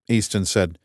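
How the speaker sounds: ordinary delivery, nothing marked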